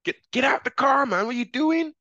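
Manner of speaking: high, pushy mock thug yelling at a driver; mock street thug. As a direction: cop in street slang, imitated. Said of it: "people doing things just like this".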